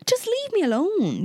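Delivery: retold plea in a high, wailing swoop; pleading protest. Retelling her plea as he keeps following her, pleading protest. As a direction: beg him off in an acted high whine.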